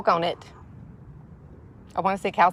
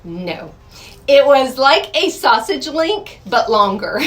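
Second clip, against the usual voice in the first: normal talking voice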